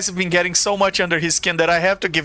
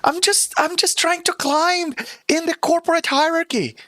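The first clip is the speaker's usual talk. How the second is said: whiny wizard; 11 semitones higher than usual. Acted. GM voices whiny NPC wizard.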